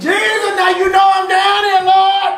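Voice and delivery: mock desperate yell. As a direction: cry out like you're at your limit. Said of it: "You don't have to scream like that".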